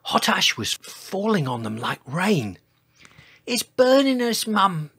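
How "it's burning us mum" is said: put-on high, loud childlike complaint; crying-out babies. A narrator's reading. Babies under hot ash calling their mother, high squeal. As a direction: squeal it like little dinosaurs. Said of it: "they cried".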